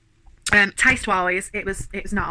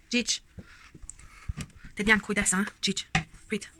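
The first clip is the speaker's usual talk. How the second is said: ordinary talk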